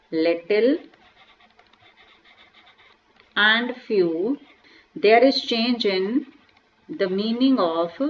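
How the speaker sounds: slow, word-by-word dictation; a slow, measured teacher dictating a rule. Acted. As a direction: read the definition slowly so the class can write it. Reads out a definition slowly.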